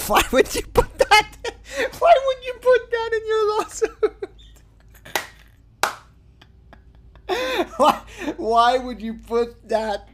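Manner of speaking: high laughing cry of disbelief; squealing with laughter. Real, not acted.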